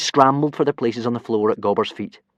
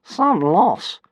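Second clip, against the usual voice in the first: higher, dismissive sneer; 9 semitones higher than usual. Acted. Dragon Fireworm sneering at Hiccup, higher and dismissive. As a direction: sniff at the loss. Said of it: "sneered Fireworm".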